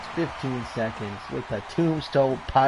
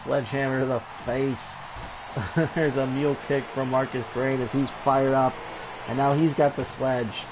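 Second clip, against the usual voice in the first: lively ringside wrestling call; energetic play-by-play over a brawl; play-by-play commentary; 3 semitones higher, 3 dB louder than usual. Acted. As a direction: call the match like a TV announcer. Live wrestling game play-by-play.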